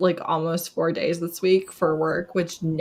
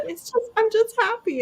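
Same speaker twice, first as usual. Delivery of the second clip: higher, half-crying plea; teary happy voice; 14 semitones higher than usual. Retold or imitated. Explaining to her kids through happy tears. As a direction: say it as if still crying. Retelling crying when husband returned.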